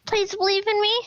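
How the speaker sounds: lightly voiced pleading companion. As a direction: beg her to trust you. Pleading with the woman.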